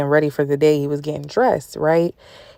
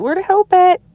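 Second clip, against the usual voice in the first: high, louder, whiny "where the help at"; mock plea to God; 4 dB louder than usual. Preacher voicing her own despair to God, high mock whine. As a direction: squeal it like an impatient child.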